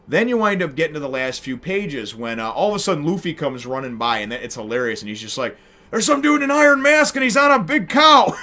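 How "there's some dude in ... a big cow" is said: high, loud excited yelling; excited shout. Retold or imitated. Luffy bursting in to tell the crew, excited shout. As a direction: blurt it out like an amazed kid.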